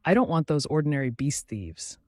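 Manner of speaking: plain reading voice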